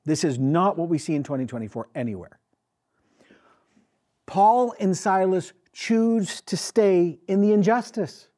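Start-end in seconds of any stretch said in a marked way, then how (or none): 4.2-8.3 slow, high emphatic restatement, Paul first; slow weighty emphasis. Acted. Pastor asks the church to sit with it, slow and emphatic. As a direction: let every word land, slowing down on Paul. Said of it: "everyone just sit with this, please".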